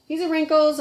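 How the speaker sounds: slack-jawed dopey puppet voice. Pretend. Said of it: "a little bit of a dopey voice".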